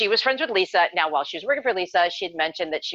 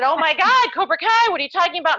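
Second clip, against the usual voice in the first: high and loud, bursting with excitement; excited fangirl yelling; 11 semitones higher, 7 dB louder than usual. Retold or imitated. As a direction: lose it over the news. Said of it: "and started screaming".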